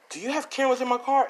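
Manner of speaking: high-pitched acted accusing question; suspicious questioning. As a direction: ask it high, as if suspecting a setup. Voicing a suspicious man's question.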